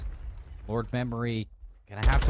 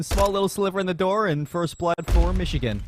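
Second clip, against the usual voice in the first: usual speaking voice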